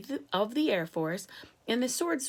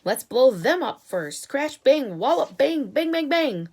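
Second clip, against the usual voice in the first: booming, much louder and higher bang bang; gleeful blasting; 7 semitones higher, 10 dB louder than usual. Acted. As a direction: shout the explosions like a kid at war. Army chief relishing explosions.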